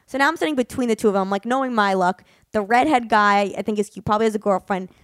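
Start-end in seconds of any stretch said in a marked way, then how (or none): none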